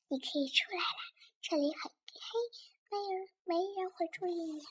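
soft conspiratorial coaxing; secretive whisper. Acted. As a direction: whisper so nobody notices. Lily secretly lets Pluck out in cinema.

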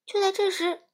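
ordinary delivery, nothing marked

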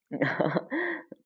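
high, short gleeful laugh; mischievous high-pitched chuckle; gleeful giggle. Acted. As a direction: giggle with delight at your own naughty plan. Delighted at imagined scolding.

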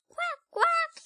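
high, bright quack; duck voice. Acted. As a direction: quack like a little duckling.

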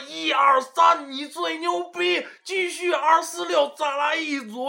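high, slow chanted count; YY shouting MC. Retold or imitated. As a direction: pump up the crowd, shouting. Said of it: "教练就像那个Y Y喊麦一样".

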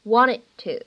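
WANTED TO with the final D of "wanted" held back, unreleased; lost plosion. Shown as a demonstration. Teacher demonstrating lost plosion to learners, "wanted to" with unreleased D. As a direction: run "wanted to" together, dropping the D release. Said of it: "失去爆破的处理".

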